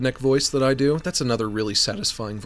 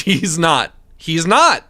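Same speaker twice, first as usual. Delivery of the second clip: louder, exasperated denial; mock insistence; 3 semitones higher, 6 dB louder, slower than usual. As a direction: flatly refuse the idea, getting louder. Insisting he is not Irish.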